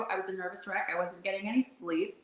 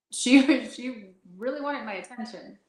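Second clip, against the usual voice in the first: normal talking voice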